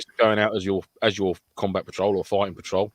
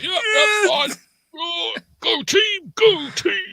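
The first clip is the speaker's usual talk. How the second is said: squeaky, much higher, slow mock-cheerful voice; mock brave reply; much slower than usual. Mocking a hurt teammate who insists he's fine, in a squeaky high drawn-out voice. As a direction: feeble forced cheer, dragged out high and slow. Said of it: "And you're like".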